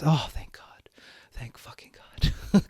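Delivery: whispered aside of relief; hushed, relieved whisper. Pretend. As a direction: breathe it out like a secret thank-you.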